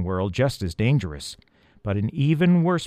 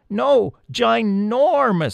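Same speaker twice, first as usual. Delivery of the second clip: high, slow squeaky insistence; emphatic mouse voice; 12 semitones higher, 5 dB louder, much slower than usual. A narrator's reading. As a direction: stretch out ginormous like a tiny mouse. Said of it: "stretching their arms to show".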